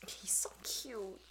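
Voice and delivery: soft whispered gush. Real, not acted. Host gushing over the puppy, slow soft whisper. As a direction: breathe it out softly and slowly.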